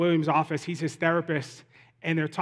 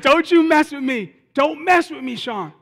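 raised, higher mock outburst; emotional outburst; 8 semitones higher, 6 dB louder than usual. Will to his therapist, retold emotional outburst. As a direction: act out an overwhelmed man lashing out, without sobbing. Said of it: "Will just gets so emotional. He just busts out in tears".

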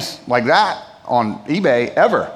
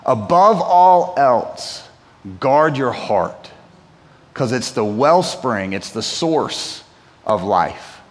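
slow, hushed, drawn-out verse; solemn scripture reading; 6 semitones lower, slower than usual. Acted. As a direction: let every word of the verse land. Preacher reads the Proverbs verse.

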